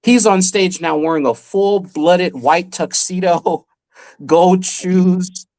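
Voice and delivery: ordinary delivery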